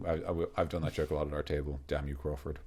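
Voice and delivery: usual speaking voice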